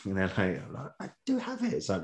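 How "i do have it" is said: very high, comic indignant squeak; mock protest. Actors told to go back to Geordie, high mock protest.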